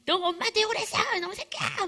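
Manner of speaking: high, loud scolding outburst; furious mother act. Acted. Mother to child who swore at her, angry yelling. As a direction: explode at the child as an outraged mom.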